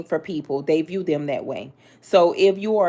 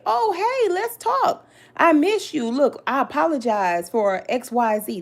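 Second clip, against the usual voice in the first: much higher, sugary fake-friendly voice; mock sweet apology; 8 semitones higher than usual. Reader mocking the backstabber's fake apology, high and sugary. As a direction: parody a two-faced person sweetly making up. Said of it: "can't come out the woodworks and say".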